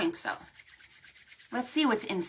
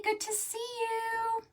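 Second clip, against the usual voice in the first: very high, soft greeting; high, soft cooing greeting; high sweet greeting; 13 semitones higher, 4 dB quieter than usual. Real, not acted. Greeting Annie.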